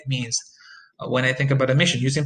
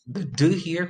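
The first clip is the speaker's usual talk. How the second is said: normal talking voice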